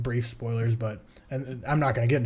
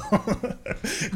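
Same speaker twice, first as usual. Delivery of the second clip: higher breathy burst of laughter; 8 semitones higher, 3 dB quieter than usual. Real, not acted. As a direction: let a breathy laugh slip out. Laughs at own teasing.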